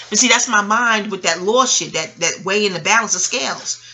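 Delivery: ordinary talk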